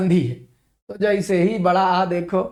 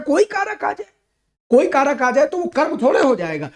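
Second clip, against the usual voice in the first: exasperated high emphasis; 6 semitones higher than usual. Shown as a demonstration. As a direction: drive home it's no object, exasperated. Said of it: "exasperated emphasis". (झुंझलाकर ज़ोर से, ऊँचे स्वर में)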